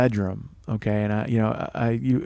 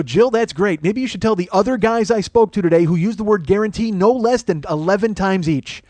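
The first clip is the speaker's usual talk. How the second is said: heated high-pitched rant, not screaming; relentless angry phone tirade; heavy-voiced yelling; 5 semitones higher, 3 dB louder than usual. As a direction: come at the listener hard, pitch raised. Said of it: "I'm yelling, all right? I'm, I've got, you know, heavy voice".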